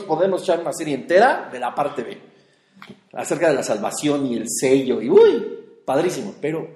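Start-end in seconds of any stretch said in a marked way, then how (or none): 5.0-5.6 "uy" jumps high and loud; a sudden delighted cry; excited yelp. Real, not acted. Preacher thrilled by the topic of salvation, a high excited cry.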